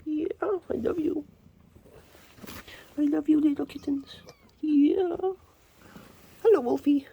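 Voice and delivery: high, slow tender coo. Real, not acted. Farmer telling kittens she loves them in high baby talk. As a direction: pour love into it.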